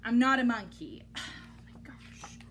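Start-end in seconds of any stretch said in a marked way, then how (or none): none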